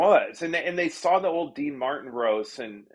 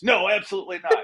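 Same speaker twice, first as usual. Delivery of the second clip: quick, higher flat-out refusal; Donald Trump; 5 semitones higher, faster than usual. Retold or imitated. Trump refusing the writer's last request, abrupt. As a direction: shut it down fast, exaggerated for the retelling. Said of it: "he was just abrupt".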